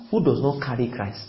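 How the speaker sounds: normal talking voice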